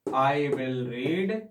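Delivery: each word stretched out for the class; teacher reading slowly for students to copy; very slow dictation. Shown as a demonstration. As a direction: dictate it at a crawl. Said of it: "मैं Dainik Jagran newspaper पढ़ूंगा".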